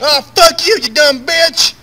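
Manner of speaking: put-on furious drunk yell; shouting back. Drunk husband screaming back at his wife, full furious shout. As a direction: bellow it straight back at her.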